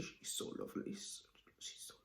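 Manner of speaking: fond whisper. Real, not acted. Gushing about her favourite chemistry teacher in a whisper. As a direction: whisper it like a secret crush.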